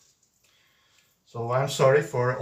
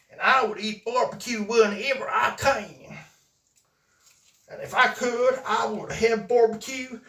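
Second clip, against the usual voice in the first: Mister O G southern drawl; 8 semitones higher, 3 dB louder than usual. Retold or imitated. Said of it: "And he does speak like this".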